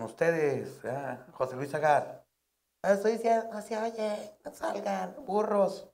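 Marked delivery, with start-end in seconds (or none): 2.8-5.9 lightly voiced high squawky announcer, a hint of fluster; mocking announcer. The comic mocks the announcer scolding the crowd, lightly higher mock voice. Imitating the announcer who couldn't be heard.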